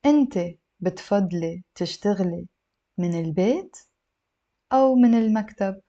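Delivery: phrase by phrase, with pauses; slow, careful model reading; a little slow. Shown as a demonstration. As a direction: break the question into small chunks for learners. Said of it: "a little bit slow".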